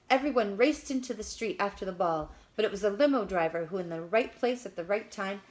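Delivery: usual speaking voice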